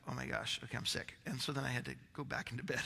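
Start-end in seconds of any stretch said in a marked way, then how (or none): 0.1-1.1 barely voiced, much softer, lower murmur; faint retold groan. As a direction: mutter it as if sick and drained. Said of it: "I was like".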